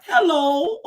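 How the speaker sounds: lightly imitated mom, high rising hello; mom's hello. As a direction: mimic the mom's hello, laughing. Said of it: "tell her I said".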